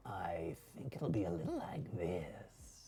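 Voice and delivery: hushed, breathy demon voice; creepy demon whisper. Shown as a demonstration. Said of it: "That's kinda creepy".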